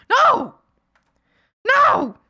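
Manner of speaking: high, loud mock yell. Ghost shouting down a hesitant friend, loud and high. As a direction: bark the refusal at them. Chicken ghost yells at waverer.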